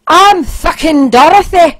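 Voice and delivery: loud, high broad Scottish brogue; thick Scottish accent. Retold or imitated. Tinkerbell snapping at the policeman, thick Scottish accent.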